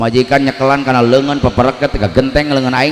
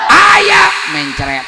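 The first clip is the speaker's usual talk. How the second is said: shouting; 6 dB louder, much slower than usual. Real, not acted. Audience shouting answer.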